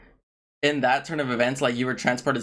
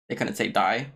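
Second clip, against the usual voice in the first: ordinary talk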